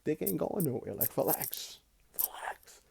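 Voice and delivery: soft, fading to a whisper; hushed calming. Retold or imitated. Telling the girl in her room to chill, hushed into a whisper. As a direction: ease her down, dropping to a whisper. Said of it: "sometimes I'd be like".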